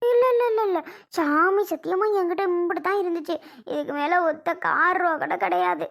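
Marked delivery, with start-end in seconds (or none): 0.1-0.8 loud, high, hurried protest; panicked denial. Acted. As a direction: blurt out frantic denials to dodge suspicion. Mother-in-law denies hiding more money.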